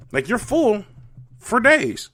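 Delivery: high, loud overstatement; mock-horrified, high and loud; exaggerated outrage. Real, not acted. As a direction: blow it way up, voice shooting high. Exaggerating burger fullness.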